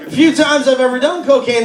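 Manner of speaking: ordinary talk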